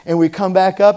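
usual speaking voice

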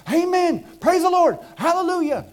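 an exuberant crowd at a missions conference; imitating churchgoers. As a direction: voice the fervent congregation praising. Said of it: "everybody's in there".

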